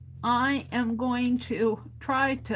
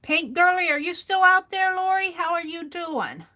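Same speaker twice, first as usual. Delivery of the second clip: calling out; 8 semitones higher, 7 dB louder than usual. Real, not acted. Calling out to Lori.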